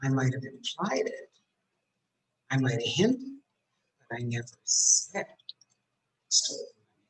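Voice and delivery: hint coyly, pausing after "said"; demonstrated knowing evasiveness; sly insinuation. Shown as a demonstration. Acts sly admission of hinting.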